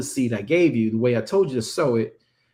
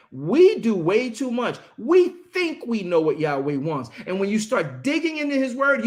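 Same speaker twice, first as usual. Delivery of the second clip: impassioned preaching; 6 semitones higher than usual. Real, not acted. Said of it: "I'm very passionate about this".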